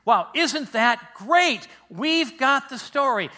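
drawn-out, high, loud enthusiasm; mock delight. Preacher voicing readers who think they've got the story, mock delight. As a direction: gush slowly and loudly, pleased with yourself.

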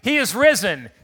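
loud call with the weight on He; loud, stressed Easter call; emphatic proclamation. Shown as a demonstration. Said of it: "Let's give it some emphasis".

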